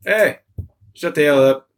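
lightly acted teacher telling off a class; imagined Australian teacher. Australian teacher to a pupil at school, mock telling-off. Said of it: "like the teacher's like".

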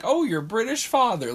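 high-pitched mock exclamation; mock remark. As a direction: tease with an exaggerated high lilt. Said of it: "Like, not like that".

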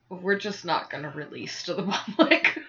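laughing through the words. Real, not acted. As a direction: chuckle out the last word. Laughing at own joke.

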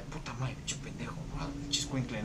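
a customer curses the employee under her breath; muttered curses. Retold or imitated. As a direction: mutter the insults to yourself. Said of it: "así de como de".

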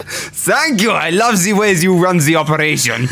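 high, strained dictator voice; Hitler voice. Acted. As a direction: play the dictator with a grin. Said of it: "Hey, Adolf!".

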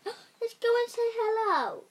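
high, bright eagerness; excited princess. A narrator's reading. Princess urging the team to land, high excited. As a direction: call out, keen to visit. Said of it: "said Princess".

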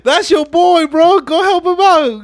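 high, loud hyped-up push; excited urging. Retold or imitated. Friends urging Dom to help, high and loud.

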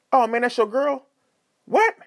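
high-pitched incredulous questioning; imitating a tactless, startled friend; mock nosy surprise. Mimics a nosy friend's blunt question.

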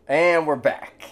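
loud, high show-opener; announcing the return. Real, not acted. Host to listeners resuming the show, loud announcing. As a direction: call it out to kick things back off.

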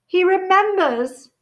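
high, slow, thrilled exclaim; delighted cry. A narrator's reading. Daedalus Diggle, thrilled Harry remembers him, cries out in delight. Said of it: "cried Daedalus Diggle".

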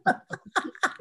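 laughing through the line; repeating the line while laughing. Real, not acted. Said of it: "repeats the line laughing".

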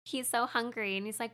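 ordinary talk

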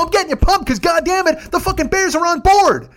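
very high, loud, ecstatic shout; retold yelling. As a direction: yell it back, voice pushed way up. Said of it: "we start just fucking yelling".

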